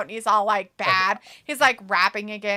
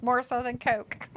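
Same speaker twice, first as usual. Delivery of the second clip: ordinary delivery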